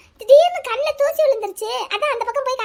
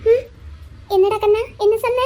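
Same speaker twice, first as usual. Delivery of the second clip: ordinary talk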